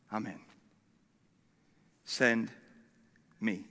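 very low, soft, with long pauses; humble surrender. Acted. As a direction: offer yourself to God in a hushed, halting reply. Isaiah's surrender to God, repeated.

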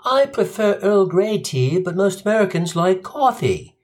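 lightly voiced British host; proper British. British judge gives Gino the final sentence, prim British. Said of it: "and she says".

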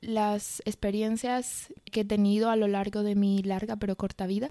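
ordinary talk, nothing marked

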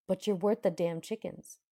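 loud, emphatic blurt. Acted. Prince answering her teasing about cows and chickens, emphatic. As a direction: punch it out as a forceful compliment. His blunt compliment in courting banter.

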